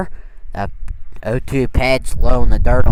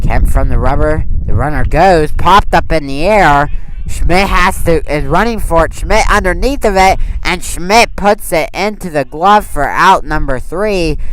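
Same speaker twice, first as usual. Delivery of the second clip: raised pitch, energetic live call; excited play call; 4 semitones higher than usual. Real, not acted. Commentator calling the runner going and Schmidt's catch, excited. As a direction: call the pop-up as it happens, voice lifting.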